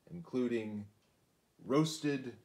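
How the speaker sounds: ordinary delivery, nothing marked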